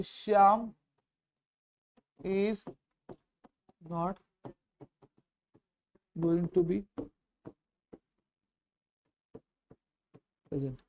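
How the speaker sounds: soft, word-by-word dictation with long gaps; slow dictation. Shown as a demonstration. Teacher to students, dictating the sentence slowly and softly. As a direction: spell it out slowly as you write. Dictating while writing on board.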